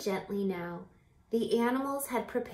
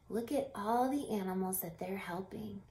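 whispered aside; 6 dB quieter than usual. Real, not acted. Teacher to children, a whispered aside. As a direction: whisper it like a shared secret. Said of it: "whispered aside".

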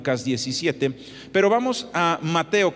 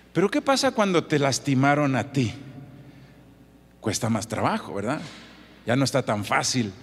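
ordinary delivery

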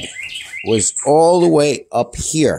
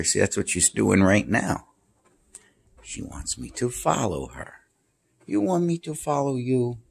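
ordinary talk, nothing marked

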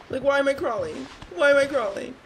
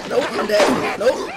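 normal talking voice

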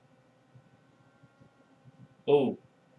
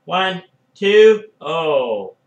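excited count; 8 dB louder, much slower than usual. Real, not acted. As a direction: count along, thrilled. Counting along with pin.

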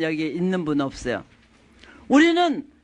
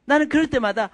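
ordinary talk, nothing marked

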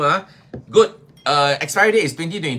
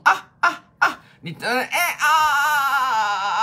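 mock pain cries; 8 semitones higher, slower than usual. Mock pain cries, dog talk.

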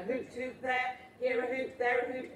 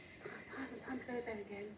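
whispering; 3 semitones lower, 16 dB quieter, much faster than usual. Acted. Putting child to sleep.

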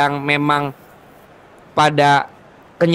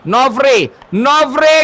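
high, loud name call; excited call; 7 semitones higher, 4 dB louder than usual. Real, not acted. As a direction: build tension on the name. Calls the kicker.

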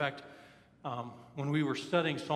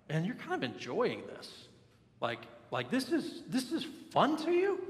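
disbelieving, leaping very high at the end; mock incredulous offence; 7 semitones higher than usual. Someone stung by a critic who seems pleased, mock incredulous. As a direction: act out wounded disbelief, pitch shooting up. Acting out someone being corrected.

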